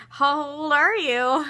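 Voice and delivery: high, loud, drawn-out question; retold disbelief. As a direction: stretch it out slowly, incredulous. Retold shock at age reveal.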